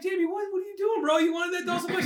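very high mock-whiny plea; pleading father. Father questioning son about the doll, high dismayed plea. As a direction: plead in a hammed-up squeak.

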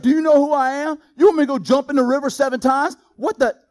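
mock-furious, high-pitched disbelief; indignant outrage. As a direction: huff like an insulted general. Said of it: "He's like".

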